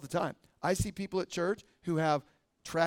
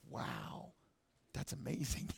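hushed, near-whispered wonder; imitated awe; 2 semitones lower, 12 dB quieter, slower than usual. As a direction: mimic stunned listeners, very softly. Acts out coworkers' awed reply.

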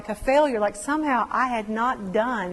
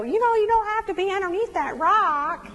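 mock advice; 8 semitones higher than usual. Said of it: "it doesn't help anybody go".

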